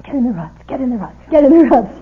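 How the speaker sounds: imitated shout, building to a loud final yell; mock shout. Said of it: "Wes going".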